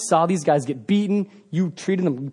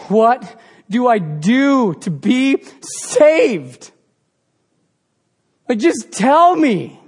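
louder, slow and pleading; desperate plea; 5 semitones higher, 4 dB louder, much slower than usual. Retold or imitated. Jailer on his face begging Paul and Silas, slow and loud. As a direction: beg for the answer, slow. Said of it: "falls to his face and goes".

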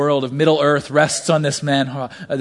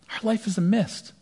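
repeated much softer, almost breathed; soft echo; 5 semitones higher, 6 dB quieter than usual. Acted. Preacher repeating his point to the congregation, hushed. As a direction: let it drift away like mist.

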